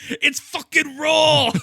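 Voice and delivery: loud high slow chef rant; bellowing mock chef; Gordon Ramsay shout. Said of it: "Where's the lamb sauce".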